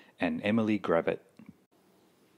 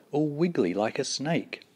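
ordinary delivery

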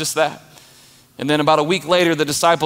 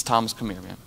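gentle call; 6 semitones lower, 7 dB quieter, faster than usual. Retold or imitated. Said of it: "He says".

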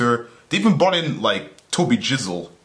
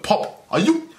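incredulous; 9 semitones higher, slower than usual. Real, not acted. Exasperated at Poppy.

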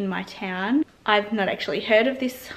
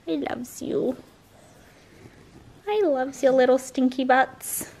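doting pet voice; 4 semitones higher than usual. Real, not acted. To her dog Alfie, doting baby talk. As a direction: coo affectionately at the pet.

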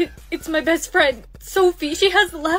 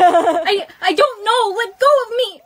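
higher, louder panicked pleading; frightened struggle; 5 semitones higher, 7 dB louder than usual. Acted. Brianna, seized by captors, cries out in fear. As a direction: struggle to break free, terrified.

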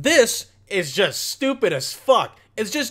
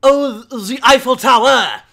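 louder, breathy mock gasp at the view; mock tourist girl; 4 semitones higher, 8 dB louder than usual. Mocking the girl on her balcony, fake awe. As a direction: play a starry-eyed tourist, lightly. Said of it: "then just fucking see".

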